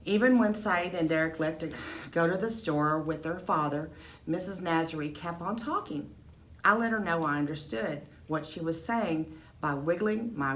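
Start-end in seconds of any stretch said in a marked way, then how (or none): none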